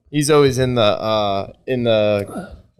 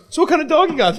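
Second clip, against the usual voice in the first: much higher, chatty guy bending to a dog; dog small talk; faster than usual. Retold or imitated. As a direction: chat up the dog owner, higher and laughing. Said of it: "bends down, like".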